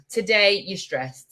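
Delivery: usual speaking voice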